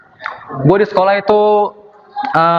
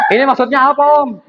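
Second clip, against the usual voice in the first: put-on kid's voice; 7 semitones higher than usual. Kid asking, high voice.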